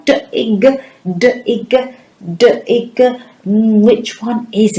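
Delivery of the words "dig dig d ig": "dig" split into d, i, g; segmented sounds. Shown as a demonstration. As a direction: sound out "dig" in separate phonemes, higher pitched. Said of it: "we will segment it".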